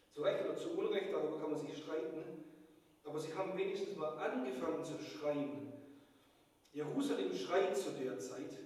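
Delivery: loud, emphatic sermon climax; preaching at full force. Acted. Preacher urging the congregation to cry out, forceful. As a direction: build it up like a rallying call.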